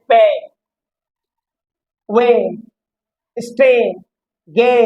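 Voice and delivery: पे, वे, स्टे, गे with a long "ay" vowel; lengthened vowel. Shown as a demonstration. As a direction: hold the "ay" in पे, वे, स्टे, गे a little long. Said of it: "ए कुछ लंबा उच्चारण देता है".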